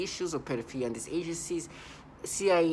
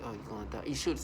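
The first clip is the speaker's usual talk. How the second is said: low, much softer mock threat; quietly hammed-up menace; menacing imitation; 5 semitones lower, 6 dB quieter, faster than usual. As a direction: imitate their creepy menacing tone. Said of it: "And they talk in this way".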